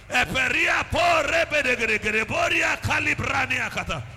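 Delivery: loud fervent prayer. Acted. Pastor leading the church to cry for God's attention, shouting. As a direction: plead and build into full tongues.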